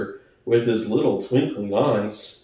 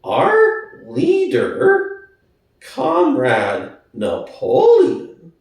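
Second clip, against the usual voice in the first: slow and firm; 2 semitones higher, much slower than usual. Acted. Squealer announcing to Boxer, slow and firm. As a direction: lay down each word slowly, firmly. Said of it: "speaking very slowly and firmly".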